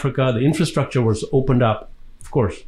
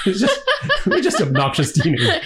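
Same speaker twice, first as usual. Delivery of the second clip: laughing; 9 semitones higher than usual. Real, not acted. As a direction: giggle through the confession. Recalls laughing with movie villains.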